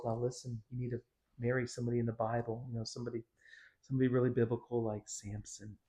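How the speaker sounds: soft mock-sincere counsel; mock-earnest parent advising daughter; sarcastic advice. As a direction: say it sweetly, dripping sarcasm. Mock advice to little girls.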